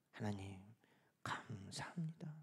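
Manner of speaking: very soft, intimate whisper; whispered thanks. Acted. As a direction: whisper it to God, overwhelmed with gratitude. Acted hushed prayer of thanks.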